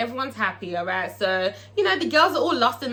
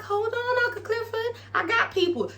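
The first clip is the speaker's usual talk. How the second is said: very high, acted placating voice; placating woman; 11 semitones higher, 3 dB quieter than usual. Toy tells Uncle Clifford to wait, high and placating. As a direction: hold them off in a high mimicking voice.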